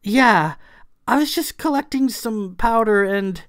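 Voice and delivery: high, loud, sheepish excuse; sheepish Clara. A narrator's reading. Clara caught with stolen powder at her feet, sheepish. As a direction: stammer it out, high and embarrassed. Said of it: "She blushed".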